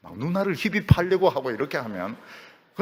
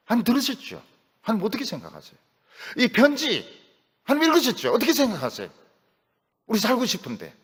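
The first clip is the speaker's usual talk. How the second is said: louder and higher, pressing God for an answer; heated prayer; 10 semitones higher, 4 dB louder, faster than usual. Acted. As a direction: push the question hard at God.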